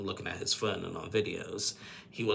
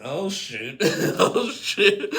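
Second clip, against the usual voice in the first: high, loud laughing exclamation; amazed and cracking up; 10 semitones higher, 8 dB louder, much slower than usual. Real, not acted. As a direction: break up laughing on the words. Laughing reaction to the fight.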